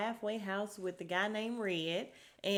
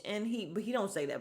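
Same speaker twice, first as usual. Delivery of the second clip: usual speaking voice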